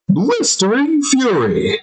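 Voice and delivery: high hammed-up yell; cartoonish furious cry; mock fury. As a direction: bellow it like a cartoon rage. Continues the mock outburst.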